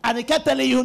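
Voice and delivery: ordinary talk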